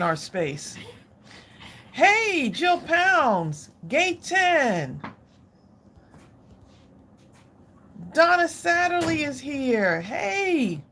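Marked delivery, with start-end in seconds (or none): 1.9-10.8 high, sing-out greeting; excited shout-out. Real, not acted. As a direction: hail friends as they arrive, pitched way up. Hailing viewers as they join.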